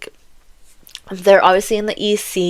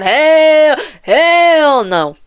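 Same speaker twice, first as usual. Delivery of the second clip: loud, high, drawn-out "hell"; emphatic refusal; 8 semitones higher, 4 dB louder, much slower than usual. Retold or imitated. King refusing to use his brother as bait, emphatic refusal. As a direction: refuse outright, raised voice, stretching the first word.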